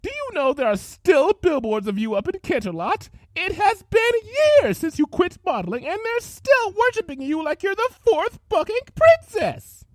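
high, loud raging tirade; furious rant. Acted. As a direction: let the jealousy boil over. Rarity ranting in rage.

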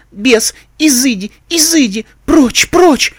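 high frantic shrieks of terror; panicked exorcism cries. A narrator's reading. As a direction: shriek at the demon to begone. Frightened girl banishing a demon. (панические крики изгнания)

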